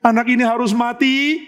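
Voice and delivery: high-pitched acted lament; grieving. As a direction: voice a father's anguish, pitch lifted. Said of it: "Daud makin sedih".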